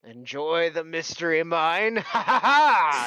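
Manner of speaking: building into a big, high, drawn-out laugh; hammy ride operator's farewell; dwarf showman. Acted. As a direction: send them off with a theatrical cackle. Dwarf sends riders off with acted laugh.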